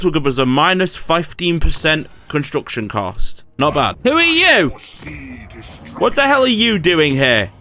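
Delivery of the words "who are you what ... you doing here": a player yelling at a trespassing enemy army, indignant; indignant outburst. Real, not acted. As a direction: demand answers, outraged.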